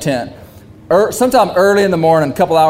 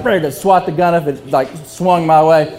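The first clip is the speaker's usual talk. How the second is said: usual speaking voice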